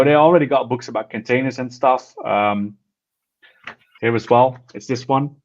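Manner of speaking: normal talking voice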